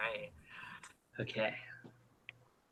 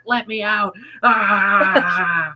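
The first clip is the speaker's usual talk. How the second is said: trapped demon; 5 semitones higher, much slower than usual. Acted. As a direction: bang on the glass and beg. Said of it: "He's like".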